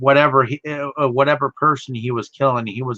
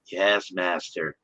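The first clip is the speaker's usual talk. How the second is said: slow, hushed, low servant; submissive quiet voice; 5 semitones lower, 8 dB quieter, slower than usual. Pretend. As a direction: drag it out, broken and meek. Broken victim obeying master.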